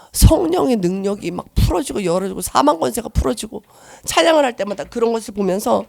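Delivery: usual speaking voice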